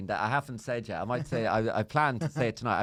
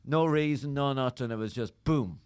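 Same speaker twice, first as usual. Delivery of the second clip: normal talking voice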